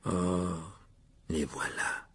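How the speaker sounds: low, soft, sour resignation; bitter Nick. A narrator's reading. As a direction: drop the voice with quiet bitterness. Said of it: "dit Nick d'un ton amer". (ton amer, grave et bas)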